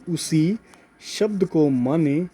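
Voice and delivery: slow, spaced-out phrases for note-taking; slow dictation. Real, not acted. Teacher dictating a note to students, slow dictation. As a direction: dictate it bit by bit, drawing words out. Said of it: "note लिखवा रहा हूँ".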